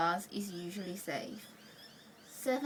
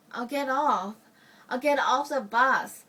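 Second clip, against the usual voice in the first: urgent resolve; 2 semitones higher, 7 dB louder than usual. Retold or imitated. Recalled urgent thought while trapped beside man.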